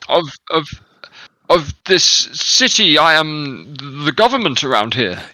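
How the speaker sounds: loud, high, indignant noble; hammy stage actor. Acted. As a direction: bluster like an outraged aristocrat. Noble keeps blustering.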